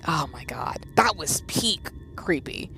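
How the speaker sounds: hushed, breathy disbelief; horrified whisper. Real, not acted. Streamer whispering about the creepy scene. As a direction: whisper it like it might hear you.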